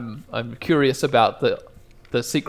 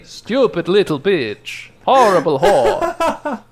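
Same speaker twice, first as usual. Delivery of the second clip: slow, mocking, rising to louder; mock insult demo; 6 semitones higher, 5 dB louder, slower than usual. Shown as a demonstration. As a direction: spit the insults slowly. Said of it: "Repeat after me".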